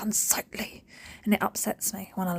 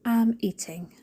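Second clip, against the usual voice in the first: slow emphasis; 4 semitones higher, much slower than usual. Real, not acted.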